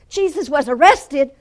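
high, loud accusing outburst; imitating a cornered accuser; loud accusation. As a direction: hurl it up as a taunting charge. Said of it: "he started falsely accusing".

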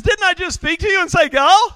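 high, loud rhetorical question; exasperated challenge. Acted. God challenging Gideon's excuses, loudly exasperated. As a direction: push it up high, building to a loud last word.

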